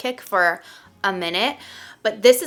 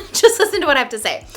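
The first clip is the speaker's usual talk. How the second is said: higher, louder and quick, with a laugh; rushed, amused insistence; laughing plea; 6 semitones higher, 7 dB louder, faster than usual. Real, not acted. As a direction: laughingly beg to be heard out. Laughing plea before expected criticism.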